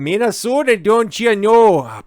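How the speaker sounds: put-on high, drawn-out Minnesota accent lilt. Teasing the state's voters in a slow high Minnesota accent. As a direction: stretch out don't you know like a Minnesotan, slow.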